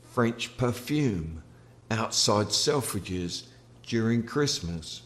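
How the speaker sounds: ordinary delivery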